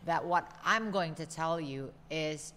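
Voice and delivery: normal talking voice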